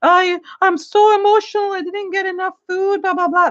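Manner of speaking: high, put-on whimpering reality-show voice; mocking whiny contestant. Vlogger mocking reality show contestants who cry over food, high mock whining. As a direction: whine it like a sulky TV contestant crying over food. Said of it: "when they're crying about".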